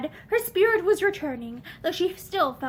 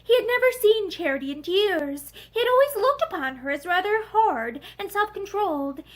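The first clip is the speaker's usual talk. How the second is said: ordinary delivery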